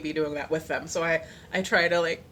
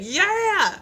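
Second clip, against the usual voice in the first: much higher, louder yell of joy; excited cheer; 11 semitones higher, 7 dB louder than usual. Retold or imitated. Worshipper when her favorite song starts, loud happy cheer. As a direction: cheer like your jam just started.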